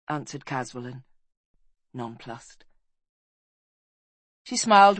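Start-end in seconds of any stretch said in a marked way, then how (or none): none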